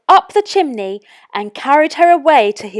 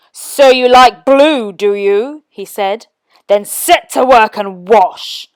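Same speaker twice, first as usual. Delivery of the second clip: louder, slow sneering order; sneering wizard; 7 dB louder than usual. A narrator's reading. Wizard sets Princess Eliza to work, sneering. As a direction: sneer then command.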